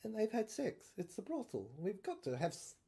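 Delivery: usual speaking voice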